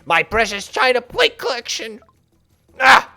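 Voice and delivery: high, loud, fussy potato; obnoxious potato voice. Pretend. Alien potato ordering the crew to remove the cat, pompous. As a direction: whine like a pompous, put-upon spud. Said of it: "Obnoxious Potato gets a distinct voice".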